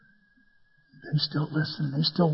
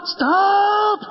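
put-on high child's shriek; high panicked child calling stop; little girl's cry; 12 semitones higher than usual. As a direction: cry out like a scared three-year-old. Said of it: "she goes".

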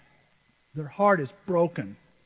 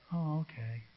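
very soft, small reply; meek child; 4 semitones lower, 14 dB quieter, slower than usual. Retold or imitated. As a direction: imitate a child quietly giving in. Child accepts apology in story.

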